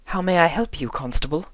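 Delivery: high, gracious inquiry; sweet and courteous, a touch of charm; Rarity politely greeting the young constable; polite refined lady. A narrator's reading. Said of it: "she inquired politely".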